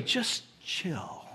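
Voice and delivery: "Just chill" said low and soft, laid-back; soft cool "Just chill". Shown as a demonstration. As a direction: ease it out like a cool friend. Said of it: "Or even this way".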